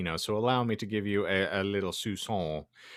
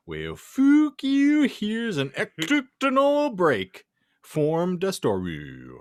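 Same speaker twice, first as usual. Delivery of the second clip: high, loud, slow sarcastic jab; mock snotty retort; 11 semitones higher, 5 dB louder, much slower than usual. Fanfic author snapping at her readers, mock snotty. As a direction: sneer it at the readers like a bratty teen.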